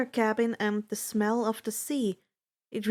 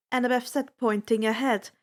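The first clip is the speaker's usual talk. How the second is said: usual speaking voice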